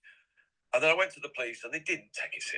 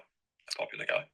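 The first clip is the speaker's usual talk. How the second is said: whispered aside; 3 dB quieter, slower than usual. Shown as a demonstration. Whispered repeat of same phrase.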